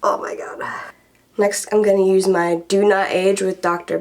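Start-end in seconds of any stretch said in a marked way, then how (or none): 0.0-0.6 breathy whispered oh my god. Real, not acted. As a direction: whisper it to yourself. Whispered reaction.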